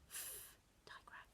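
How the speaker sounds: whispered letter sounds. Shown as a demonstration. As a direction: breathe it softly. Whispered letter during flashcard drill.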